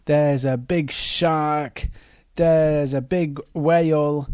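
usual speaking voice

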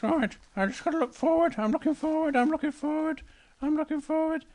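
high cub voice reciting to himself; Little Bear. Acted. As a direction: chant it like a small bear trying to behave. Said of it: "So Little Bear just sat looking forward".

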